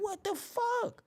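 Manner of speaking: high and slow disbelief; hushed high what the fuck; appalled. Acted. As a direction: stretch it out, stunned. Appalled by smell.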